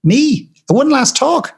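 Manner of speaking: high, fluttery protest brushing off the praise; flustered modest refusal. Retold or imitated. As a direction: squeak it out, waving the idea away. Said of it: "she did what almost everybody does".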